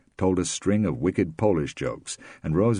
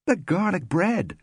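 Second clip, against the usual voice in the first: cried out; 3 dB louder than usual. A narrator's reading. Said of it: "Rosemary cried".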